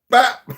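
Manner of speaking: high, loud popped "bop"; short, sharp impact imitation; mock punch noise. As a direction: punch out a cartoon hit sound. Imitates the hit landing.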